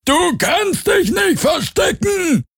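very high, slow creature shout; high drawn-out hunter's cry; distant menacing call. A narrator's reading. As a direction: screech it out slowly in a piercing alien voice. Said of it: "der Ruf ertönte".

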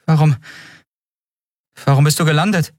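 high-voiced, bewildered questioning; confused. A narrator's reading. Endriel asks Kero why he landed, confused. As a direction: break off on the first word, then ask in confusion. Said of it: "fragte Endriel verwirrt".

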